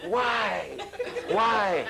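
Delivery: imitating children's plea, drawn out; pleading. Children pleading with their teacher for a reason, mock pleading. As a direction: plead like a puzzled pupil, mock-earnest. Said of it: "And they plead".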